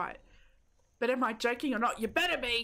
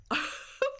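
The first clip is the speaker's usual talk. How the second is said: high breathy laugh; short high giggle; high squeaky giggle; 14 semitones higher than usual. Real, not acted. Self-deprecating laugh.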